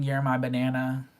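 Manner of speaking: ordinary delivery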